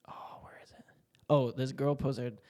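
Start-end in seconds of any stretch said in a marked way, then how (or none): 0.1-0.8 whisper. Real, not acted. Searching for the comment.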